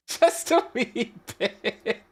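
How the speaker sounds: real laugh splitting the phrase; helpless giggling. Real, not acted. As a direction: giggle through the words. Laughing at the broken software.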